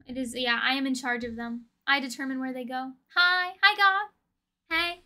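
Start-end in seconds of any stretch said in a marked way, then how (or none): none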